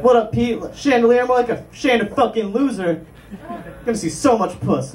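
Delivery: high, cocky crowing lecher; gloating pervert bathtub. Acted. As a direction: strut like a creep let loose. Pervert bathtub's gleeful first day.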